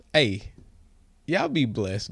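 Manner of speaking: ordinary delivery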